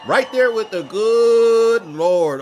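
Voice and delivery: sarcastic hallelujah voice; mock heavenly. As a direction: stretch it out like a hymn. Mocking rapture.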